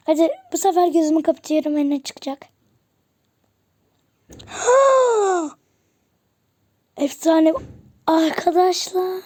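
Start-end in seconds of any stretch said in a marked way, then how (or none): 4.5-5.5 high surprised gasp; sharp gasp. Real, not acted. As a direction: gasp in amazement. Gasp at the rare pull.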